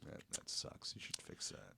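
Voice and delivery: whispering. Real, not acted. Hushed sympathy.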